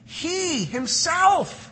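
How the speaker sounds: "himself" stretched high and slow; emphatic he himself. Shown as a demonstration. Preacher showing the emphatic phrase, "himself" drawn out high. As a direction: lean hard on "He himself". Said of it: "the phrase is emphatic".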